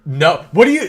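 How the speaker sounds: ordinary talk, nothing marked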